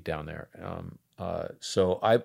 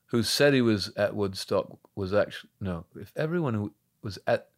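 put-on London English accent; 3 semitones higher than usual. Actor recalling his first Buffy audition line, London accent. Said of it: "That's such a good accent".

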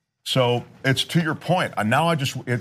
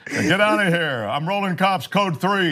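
raised-pitch, playful command; mock tough threat; 7 semitones higher than usual. Host warning off an intruder, mock tough. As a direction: act big and bad at the intruder. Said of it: "I wanna sound big and bad".

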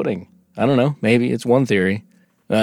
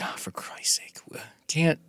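whispered, fed-up sigh of words; exasperated whisper; 7 semitones higher, 11 dB quieter than usual. Retold or imitated. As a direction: whisper it like a disappointed parent. Exasperated aliens returning.

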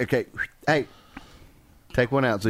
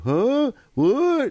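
mock confused; 12 semitones higher than usual. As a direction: play a clueless guy who can't hear. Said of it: "Don't sit there and go".

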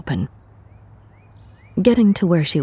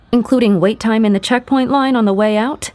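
sarcastic, higher and louder; snarky; 7 semitones higher, 5 dB louder than usual. A narrator's reading. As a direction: snap it back with sarcasm. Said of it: "Emma snarked".

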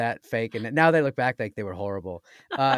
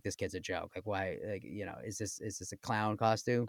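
mocking casting director; 5 semitones lower, 12 dB quieter than usual. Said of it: "She was like".

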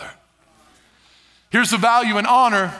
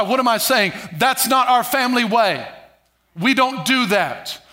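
ordinary delivery

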